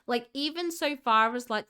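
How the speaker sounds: ordinary talk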